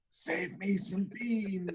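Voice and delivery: slow, faint dying gasp; dying last words. Pretend. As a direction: fade out on last breath. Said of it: "hold up your hand and go".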